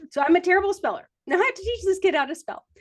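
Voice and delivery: laughing through the words; a giggly admission. Real, not acted. Laughing at herself to the listener.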